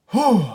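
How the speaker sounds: loud, high exhaled whew; big whew. Real, not acted. Reviewer gushing over beautiful visuals, big whew. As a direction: blow out an impressed whew.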